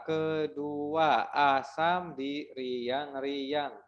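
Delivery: "kedua asam di riang-riang" very slow, syllables apart; counting syllables. Shown as a demonstration. Teacher counting the second pantun line. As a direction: stretch "riang-riang" beat by beat. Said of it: "ini ada sepuluh kata".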